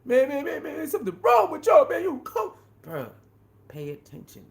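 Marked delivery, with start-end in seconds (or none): 0.0-2.5 high, loud, fast mock rant; mocking angry critics. Imitating people who call Wayne names, high fast angry rant. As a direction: rattle it off like an irate know-it-all. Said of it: "a lot of people who talk like that".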